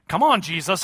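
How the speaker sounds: ordinary talk, nothing marked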